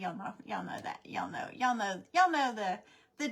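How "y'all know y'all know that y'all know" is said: whispering. Real, not acted. Conspiratorial aside to viewers.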